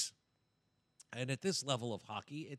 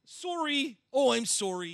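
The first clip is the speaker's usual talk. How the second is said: mock apology; 12 semitones higher, 10 dB louder, slower than usual. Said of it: "I should be taking the Lord's name in vain".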